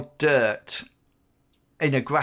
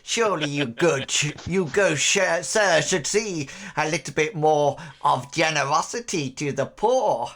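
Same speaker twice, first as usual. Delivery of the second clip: wheedling crone begging for more; protest, then coax like an old beggar woman; crackly old woman's bargaining voice; haggling old hag; 4 semitones higher than usual. Acted. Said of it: "this toothless hag of a woman".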